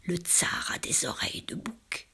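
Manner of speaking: hushed unvoiced whisper; soft, breathy-voiceless murmur; murmured secret. A narrator's reading. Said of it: "il murmura".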